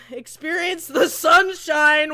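ordinary talk, nothing marked

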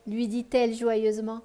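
put-on lively read-aloud voice for children; expressive bedtime story reading; story narration. A narrator's reading. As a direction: tell the tale warmly to a child listener.

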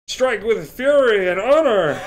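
slow, loud heroic war cry; in-character battle cry. Retold or imitated. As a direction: roar it like a knight rallying troops. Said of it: "And he's like".

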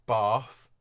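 RP BATH with long back "ah"; RP accent. Shown as a demonstration. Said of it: "R P in sort of most of London".

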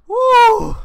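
very high loud shriek; startled shriek. Real, not acted. Player shrieking at a jump scare, very high shriek.

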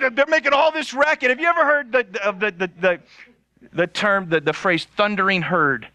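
ordinary talk

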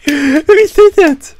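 very high, loud, slow victory scream; ecstatic scream. Real, not acted. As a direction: scream with joy. Team wins the match.

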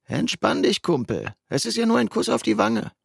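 buddy Justin's voice. Acted. Narrator voices friend Justin.

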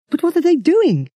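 high, frightened question; a touch of shivering fear. Acted. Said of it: "He was shivering now".

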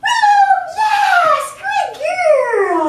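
high, loud sing-song call to the dog; bright praise, sung high and loud. Shown as a demonstration. Trainer calling the puppy by name in a sing-song. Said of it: "We want that sing-song voice".

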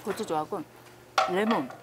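chewing while talking; speaking between bites; mouth full of food. Real, not acted. Seen 2: Fork in hand, cheeks bulge, chewing throughout; meat type unverifiable.

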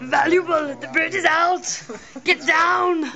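high, loud hammed-up call; mock shouting. Actor joking about being too valuable for stunts, high mock shout. Clowning, repeats valuable loudly.

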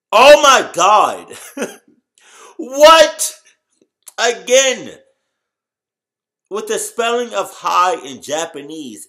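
very loud, high, slow disbelief; shocked outburst. Real, not acted. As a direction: scream at the spelling. Shocked by Japanese spelling.

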